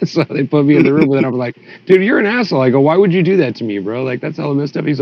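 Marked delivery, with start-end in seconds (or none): 1.9-4.9 higher, half-mock outrage at a friend; retold indignant confrontation. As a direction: call him out, hurt and annoyed, in a retelling.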